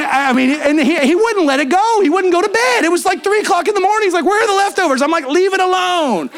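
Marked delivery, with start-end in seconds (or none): 4.3-5.0 insistent friend. Retold or imitated. 5.3-6.3 weary brush-off. Retold or imitated. Tired of friend's searching.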